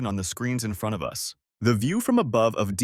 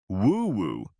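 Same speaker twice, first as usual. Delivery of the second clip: spoken siren whoop, rising then dropping; imitated police siren; 3 semitones lower than usual. A narrator's reading. Narrator voices the police car's signal, mimicking a siren. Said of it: "This sound is almost universally recognized as a police signal".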